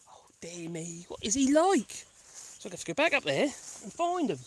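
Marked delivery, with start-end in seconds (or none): none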